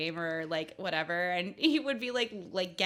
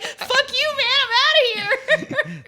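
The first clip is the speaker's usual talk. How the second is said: high, loud, slowed mock fury; hammed-up furious exit line; mock angry outburst; 10 dB louder, slower than usual. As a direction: storm off in a hammed-up huff. Retold angry chat partner.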